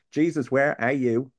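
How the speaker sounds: loud, slow, pleading demand; calling out to Jesus. Retold or imitated. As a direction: call out for him like he's gone missing. Honest angry prayer to God.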